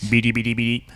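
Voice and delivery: lightly voiced beeping robot; robot sound imitation. Mocking the annoying robot's noises, lightly imitated robot sound. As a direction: mimic a robot's repetitive babble. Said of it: "every time that robot made a sound".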